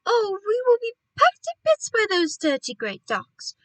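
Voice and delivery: high, loud wail of dismay; alarmed cry. A narrator's reading. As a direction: fret out loud, pitched well up. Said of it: "cried Missus Gregg".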